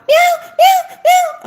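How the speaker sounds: very high, loud kitten meows; shrill mock cat cries at dawn; loud imitated meow. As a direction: yowl like a wide-awake kitten. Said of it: "He jumps out".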